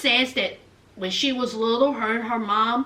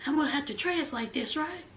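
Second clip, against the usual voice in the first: soft, fast whisper, nearly voiceless; translator whispering; 16 dB quieter than usual. Retold or imitated. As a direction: murmur it under your breath like an interpreter. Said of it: "The translator was like this".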